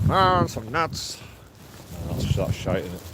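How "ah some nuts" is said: American accent. Shown as a demonstration. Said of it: "my American accent is rubbish".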